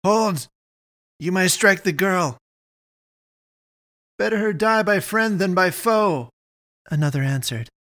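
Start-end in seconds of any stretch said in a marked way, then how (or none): 0.1-2.4 higher, urgent cry; moderately raised, alarmed call. A narrator's reading. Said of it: "I heard Dushan cry". 4.2-6.3 high-pitched hard retort; raised, grim declaration; calling back. Acted. Villager calls back in the fight.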